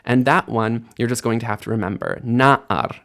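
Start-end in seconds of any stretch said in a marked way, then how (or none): 2.2-3.0 Na'ar with the weight on NA; first-syllable stress. Shown as a demonstration. Teacher giving the form to remember, first-syllable stress on Na'ar. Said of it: "that's where the emphasis is".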